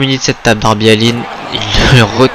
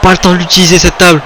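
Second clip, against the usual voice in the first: excited fast call; 5 semitones higher, faster than usual. Real, not acted. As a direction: rush the call.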